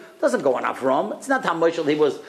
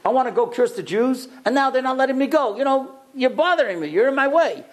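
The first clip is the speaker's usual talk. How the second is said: whiny Bilam; 7 semitones higher than usual. Retold or imitated. Bilam voiced, complaining.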